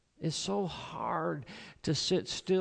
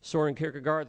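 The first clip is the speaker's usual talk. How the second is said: ordinary delivery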